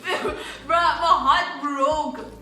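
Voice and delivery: loud, drawn-out theatrical anguish; mock heartbreak. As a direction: stretch every word, hamming up the pain. Mock-tragic end of the story.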